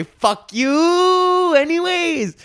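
very high, loud sung-out retort; mock defiance. Host defies critics of the park visit, high mock defiance. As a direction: sing it out high and hold the note.

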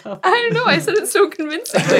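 high, loud words through laughter; giggling out the excuse; laughing. Real, not acted. Player to GM, laughing about her convincing lie.

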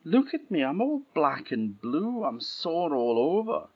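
high, whining princess; whiny complaint. Acted. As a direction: moan about the bruises. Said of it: "she was complaining so much".

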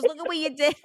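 high teasing dad; lightly voiced teasing father. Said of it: "He goes".

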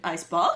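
"Icebox" rising like a question; upward inflection. Shown as a demonstration. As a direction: lift the end of "Icebox" in Australian style. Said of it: "with an upward inflection".

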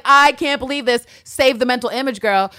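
high, loud giddy disbelief; giddy squeal. Retold or imitated. Villanelle seeing Eve in her bed, high and loud. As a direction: squeal it to yourself. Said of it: "She's like".

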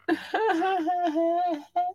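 high-pitched giggle. Real, not acted. Laugh after [name] line.

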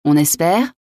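incredulous echo, lifting at the end; exclamation. A narrator's reading. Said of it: "s'écria La Mole". (reprise indignée, plus aiguë)

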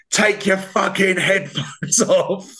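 high, slow mock shout; hammed-up yell at a runner; retold shout. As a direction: bark it out like an angry marshal. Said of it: "shouting at me like that".

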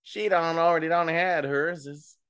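loud, high-pitched weird catchphrase voice; high, loud, mock-announcement voice. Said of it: "But in that really, like, weird voice".

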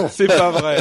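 loud laughing "no way"; laughing disbelief. Real, not acted. Amazed disbelief at old computer. (incrédule, en éclatant de rire)

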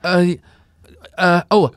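ordinary talk

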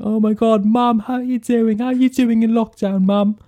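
high squealy mock-American gush; mocking James Corden. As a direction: send up a showy TV host greeting his mum. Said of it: "talking away to his mother".

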